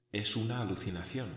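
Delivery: hushed, barely voiced doubt; quiet inner thought. A narrator's reading. Alone in the dark cave, doubting himself, hushed. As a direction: murmur it to yourself in disbelief. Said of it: "pensé".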